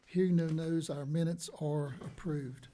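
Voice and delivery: ordinary delivery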